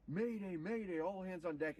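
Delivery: high frantic mayday call; panicked sailors. Retold or imitated. Jonah's crewmen crying out in the storm, high and frantic. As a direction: crewmen shouting for help in the storm. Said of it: "all the crewmen are like".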